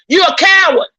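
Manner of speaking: coward said with mounting heat; real anger. Real, not acted. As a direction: let the upset show on coward. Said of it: "I'm getting upset".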